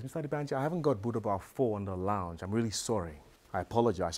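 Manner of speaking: soft, low, unruffled apology; very calm. Retold or imitated. Driver apologizing to his passenger over music, very calm. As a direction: imitate an unflappable driver, hushed and low. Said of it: "looks at me very calmly".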